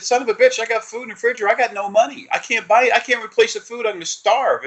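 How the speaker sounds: high-pitched whiny rant; mock panicked rant. Complaining to his energy about the food, high whiny rant. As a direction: squeal out the complaint, hammed-up. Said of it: "I said".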